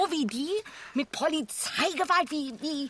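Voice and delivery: empörte, höhere, lautere Vorwürfe; aufgebracht. Acted. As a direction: wirft es ihm entrüstet an den Kopf. Heated argument about the stranger. (Frau hält dem Partner empört den Polizeieinsatz vor, aufgebracht)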